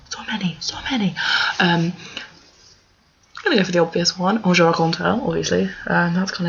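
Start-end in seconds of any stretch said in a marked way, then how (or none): 0.1-1.2 breathy unvoiced whisper. Real, not acted. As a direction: whisper it, overwhelmed. Whispered overwhelmed aside.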